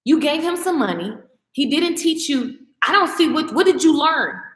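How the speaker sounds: normal talking voice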